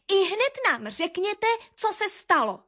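high, indignant bird voice; cross demand. A narrator's reading. Eldest tit confronts the quarrelling birds, cross demand. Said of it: "rozlobila se nejstarší koňadra".

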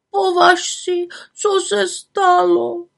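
put-on high, wailing sob of old women; sobbing old women. Old women sobbing to the little witch about the forester. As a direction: weep it out, heartbroken. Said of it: "vzlikaly babičky".

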